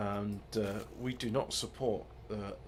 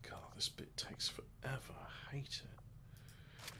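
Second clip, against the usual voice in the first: whispered, softer grumble under the breath; annoyed whisper; 2 semitones higher, 11 dB quieter, slower than usual. Real, not acted. Waiting through a slow menu, a whispered grumble. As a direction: whisper the complaint to yourself.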